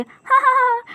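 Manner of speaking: high ha ha ha; sparrow's high mocking laugh. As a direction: laugh at the foolish crows. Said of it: "चिड़िया हंसने लगती है".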